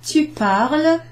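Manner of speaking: tu parles lifted high, sounding like parle; French model phrase. Shown as a demonstration. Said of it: "you add an E S".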